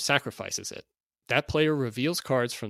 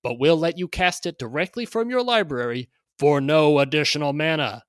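carnival barker; 3 semitones higher, 6 dB louder than usual. Acted. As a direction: hype it like a fairground barker. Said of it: "read this in sort of a carnival barker".

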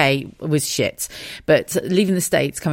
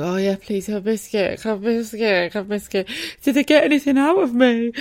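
groggy post-op self; 6 semitones higher than usual. Retold or imitated. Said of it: "I was like".

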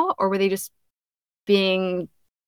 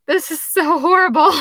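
cringing, higher and louder; exasperated; 9 semitones higher, 6 dB louder than usual. Retold or imitated. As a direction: groan it out in mock horror. Reacting to reading her own habit.

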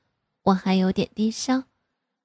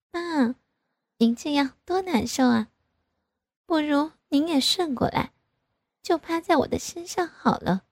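higher, playful coaxing; teasing daughter; 7 semitones higher than usual. A narrator's reading. Daughter teasing father, much higher voice. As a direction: tease him with a lilt. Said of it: "就笑着说".

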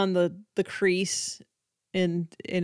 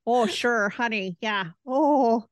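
hammed-up Minnesota accent, higher and building louder; mock-seductive Minnesota accent, oh you betcha; 4 semitones higher, 4 dB louder than usual. Teasing her co-host with a jokey Minnesota come-on, mock accent. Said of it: "really strong Minnesota accent".

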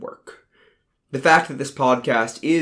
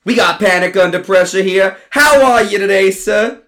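much louder, higher, enthusiastic; hyped host; 7 semitones higher, 8 dB louder than usual. Acted. Ronnie introducing the guest, a loud hyped host voice. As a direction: hype the guest up.

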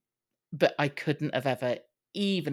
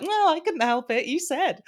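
put-on much higher whiny child voice, sulking; petulant child; 12 semitones higher, 5 dB louder than usual. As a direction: whine it like a sulky kid. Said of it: "almost like this petulant child".

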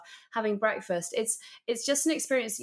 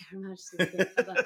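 echoing the royal title through laughter; playful laughing mimicry; laughing imitation. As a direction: repeat it amused, laughing. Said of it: "Did you see how I said that?".

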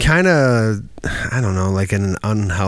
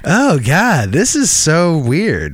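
slow disbelief; 6 semitones higher, slower than usual. Retold or imitated. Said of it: "I'm like".

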